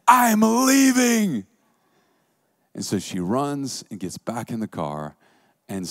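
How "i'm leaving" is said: slow, high, loud parting shout; hammed-up high cry. Woman leaving Alex, slow high shout. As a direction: drag it out as she storms off.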